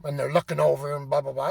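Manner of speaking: normal talking voice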